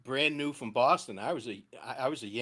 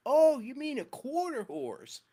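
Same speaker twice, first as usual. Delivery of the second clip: slow, higher local drawl on quarter horse; a slow, higher mock local twang; 10 semitones higher, slower than usual. A bystander finally catches on, in a slow higher local drawl. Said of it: "He goes".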